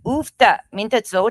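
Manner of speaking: broad Upper Midwest accent on Minnesota. Shown as a demonstration. Upper Midwest accent, teasing the host about her home state. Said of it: "I'm from North Dakota originally".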